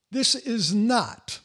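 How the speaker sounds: slow, deliberate "this is not", landing hard on "not"; stress on NOT. Real, not acted. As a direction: draw it out and hit "not". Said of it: "I have to emphasize the word not".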